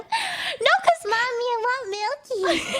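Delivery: high baby-talk impression, drawn out at the end; mock someone as a high-voiced toddler; whiny little child. Mocking the man as he yells, high whiny baby voice.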